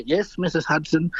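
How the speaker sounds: a soft, hushed hint of Marlon Brando, politely deferring; gently imitating an old film star being meek; lightly voiced Brando. Storyteller voicing Brando answering his mum, softly and meekly.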